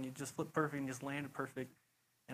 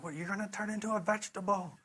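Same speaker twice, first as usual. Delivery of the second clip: imitating mom; 7 semitones higher, 5 dB louder than usual. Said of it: "Mom was always like".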